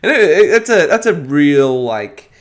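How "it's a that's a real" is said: ordinary talk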